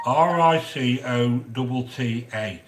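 "R I C O T T A" said letter by letter, slowly; spelled out. Shown as a demonstration. Spelling "R I C O T T A" slowly for Gail in the chat.

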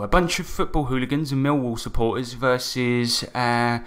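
ordinary talk, nothing marked

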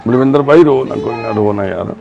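calm a weeping man down; tender reassurance to a crying friend; comforting. Real, not acted.

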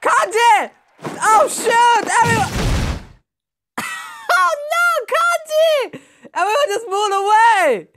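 high, excited cries of dismay; alarmed outcry. Real, not acted. Gamer sees the crowd move away from Kanji, alarmed outcry. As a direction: wail out the name in shock.

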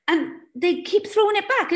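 usual speaking voice

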